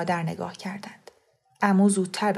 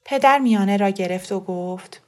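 ordinary delivery, nothing marked

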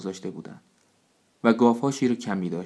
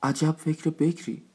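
admiring cry; 7 semitones higher, slower than usual. A narrator's reading. Elder praises the leader's new plan, high admiring cry. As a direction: marvel at it slowly, pitched up. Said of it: "صفی فریاد زد".

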